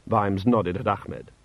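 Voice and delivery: ordinary delivery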